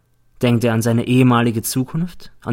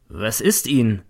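ordinary delivery

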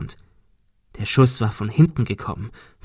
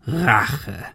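lightly voiced villain scoffing; hoarse villain. A narrator's reading. Gunman scoffs at Ben's accusation, hoarse villain. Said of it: "Der Mann im Nebel lachte heiser".

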